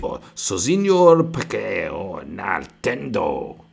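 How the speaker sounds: loud, slow hammed-up Spanish accent; a mocking fake Spanish accent, slow and loud. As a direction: parody a Spanish singer, drawing it out. Mocking Spanish-speaking streamer.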